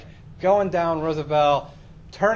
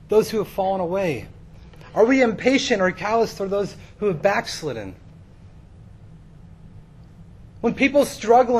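impassioned preaching; 8 semitones higher, 5 dB louder than usual. Real, not acted. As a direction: build to a forceful sermon declaration. Preaches questions at full force.

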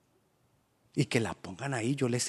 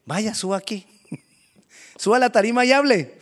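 coaxing invitation; 7 semitones higher, 9 dB louder than usual. Acted. Someone inviting to the stage.

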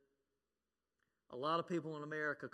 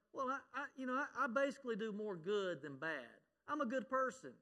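higher, a bit louder, imitating a self-assured churchgoer; mock self-righteous churchgoer; 8 semitones higher, 4 dB louder than usual. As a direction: play the smug 'good person' defending himself. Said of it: "Well, you know".

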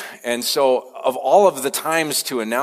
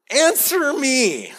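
"Answer me." much higher and slow, drawn out; demanding God; 14 semitones higher, much slower than usual. Retold or imitated. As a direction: stretch "Answer me." out high and slowly as God. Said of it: "the next thing that God says is".

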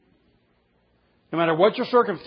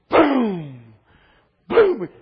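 imitated pounding; 3 semitones higher, 7 dB louder than usual. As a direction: punch out the banging on the door. Child beating on locked door.

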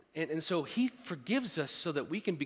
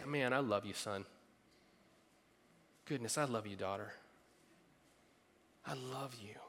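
soft, low, tender; tender God voice; 5 semitones lower, 10 dB quieter than usual. Acted. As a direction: speak love softly like a father.

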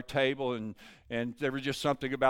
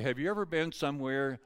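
ordinary delivery

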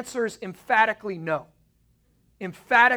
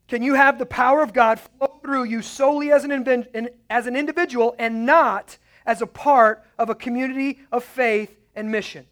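pitched up and louder, pressing the point; louder restated question; 5 semitones higher, 6 dB louder than usual. Shown as a demonstration. Preacher pressing his congregation again, raised and forceful. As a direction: drive the question home harder. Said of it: "I'll make this statement".

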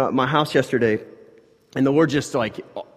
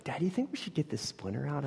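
put-on quiet child; 14 dB quieter, faster than usual. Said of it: "She's like".

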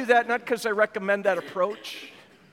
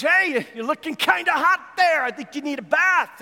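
mocking taunt; 7 semitones higher, 5 dB louder than usual. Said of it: "another guy comes up and says".

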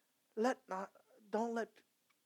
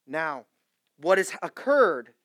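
full-force preaching; 12 dB louder than usual. Acted. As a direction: preach it to the rafters.

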